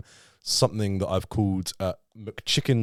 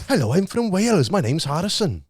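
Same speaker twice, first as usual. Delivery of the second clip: Welsh accent; 9 semitones higher than usual. Shown as a demonstration. Host showing the guest how Welsh sounds, Welsh accent. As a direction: demonstrate a lilting Welsh accent, pitched up. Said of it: "do it in a Welsh accent".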